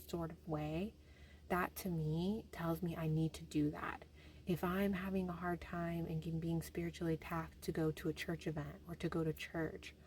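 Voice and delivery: ordinary talk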